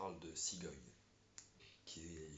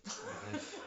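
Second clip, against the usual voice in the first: cracking up while trying to move on; laughing; 4 dB louder than usual. Real, not acted. Boyfriend laughing at partner's crying confession, breaking up. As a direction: laugh through the word, much higher.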